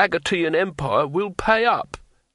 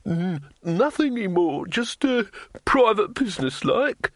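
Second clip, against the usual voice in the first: much higher, stammering and nervous; nervous innkeeper; 7 semitones higher than usual. A narrator's reading. Fat innkeeper cornered by the thief master, stammering nervously. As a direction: squeak out excuses under pressure. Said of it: "said the innkeeper nervously".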